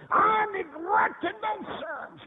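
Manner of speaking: high, drawn-out imitated wail; anguished confession. Mother confessing to the preacher at her son's wake, wailing anguish. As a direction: cry out as the guilt-stricken mother.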